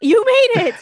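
excited cheer. Real, not acted. Cheering Chris for making it.

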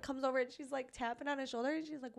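ordinary talk

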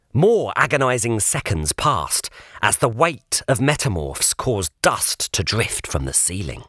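usual speaking voice

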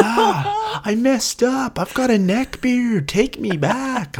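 high, slowing mock whine of a desperate suitor; whiny pleading guy. Friend mocking the returning contestant's plea, high whiny voice. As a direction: plead it high and drawn out like a pathetic ex.

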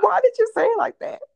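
high, loud, incredulous; playfully shocked question; amused surprise. Real, not acted. Teasing her sister.

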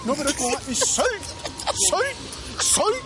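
exaggerated British accent on salt. Shown as a demonstration. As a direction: say salt like a Brit. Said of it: "El acento británico".

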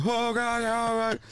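very high mock cry of fear; squealing oh God, high and scared; freaked-out wife. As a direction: imitate the wife panicking over the bat. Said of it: "and she was like".

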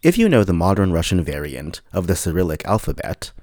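normal talking voice

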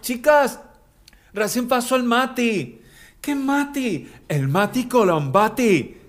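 put-on high, excited girly gossip voice; imitating a swooning girl. Girl telling her friends that Mati walked by, high and excited. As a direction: squeal it like a fan spotting him. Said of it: "Ella dice".

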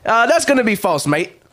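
put-on loud, botched British accent; awful English accent. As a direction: overdo the British slang, accent slipping. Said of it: "your accent was fucking awful".

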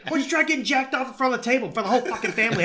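fast, high angry tirade; loud, rapid-fire imitated scolding; intensely angry. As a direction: rattle off a furious movie rant. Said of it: "intensely angry".

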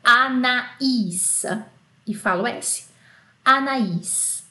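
"Anaís" split a-na-ÍS, stress on the end; French name Anaís. Shown as a demonstration. Teacher modelling "Anaís" for the class. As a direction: pronounce "Anaís" with separate A and I. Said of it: "a gente perde essa característica".

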